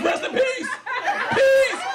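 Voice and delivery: squealed through laughter, high and loud; shrieking laughter on peace; laughing. Acted. A comedian cracking up reading a note, laughing.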